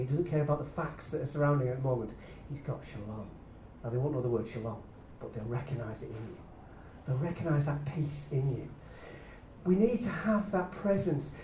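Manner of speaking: ordinary talk, nothing marked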